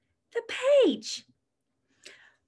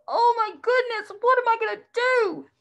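high, fast mock panic; mock scared; 9 semitones higher, 4 dB louder, faster than usual. Playful fake fright for the preschoolers. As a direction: pretend to panic at the bear. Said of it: "I would go".

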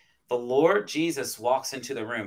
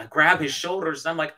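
usual speaking voice

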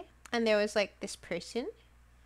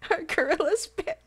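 laughing question; 7 semitones higher, 3 dB louder than usual. Real, not acted.

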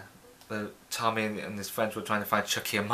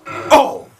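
sudden startled cry; 11 dB louder than usual. Real, not acted. Hit in game.

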